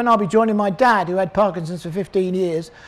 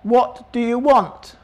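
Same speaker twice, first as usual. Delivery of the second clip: slow, gentle question; 2 semitones higher, slower than usual. Retold or imitated. Jesus gently asking the blind man his need. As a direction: ask him kindly, taking your time. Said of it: "Jesus says a really odd question".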